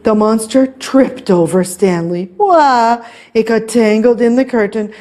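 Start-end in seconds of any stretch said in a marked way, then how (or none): 2.4-3.1 higher startled wah; tumbling cry. A narrator's reading. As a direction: yelp as if tripping. Monster trips over.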